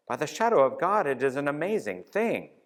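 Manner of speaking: a knight crying out in wonder; astonished cry. A narrator's reading. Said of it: "de Metz cried out".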